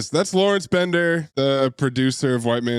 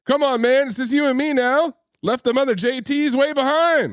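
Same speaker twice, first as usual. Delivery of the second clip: imitating Joshua; 11 semitones higher than usual. Voicing Joshua to Cynthia.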